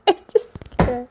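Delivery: high, breathless laughing squeak; real giggle fit, barely voiced; helpless laughter. Real, not acted. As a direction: collapse into giggles on the words. Giggle fit during reading.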